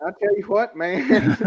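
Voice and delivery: high-pitched Southern put-on; playful Southern American impression; mock Southern accent. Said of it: "having a Southern accent".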